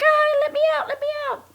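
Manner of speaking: very high, loud mock scream; retold panic. Woman begging out of a speeding car, mock-panicked. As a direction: imitate a frantic passenger, high and loud.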